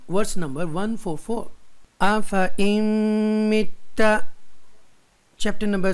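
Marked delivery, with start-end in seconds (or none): 2.0-4.1 आफा इम्मिता slow, with a long held m; recitation demo. Shown as a demonstration. Teacher shows students the zaid alif word, slow आफा इम्मिता. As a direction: sustain the nasal in आफा इम्मिता. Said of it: "ज़ैद अलिफ को नहीं पढ़ेंगे".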